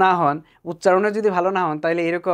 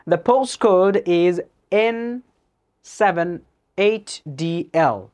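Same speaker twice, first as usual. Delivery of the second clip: very slow, letters spaced out; slow dictation read; 3 dB louder, much slower than usual. Shown as a demonstration. Teacher reading a postcode from a listening test: slow dictation. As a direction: spell it out slowly like a test recording. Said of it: "কইলো কি".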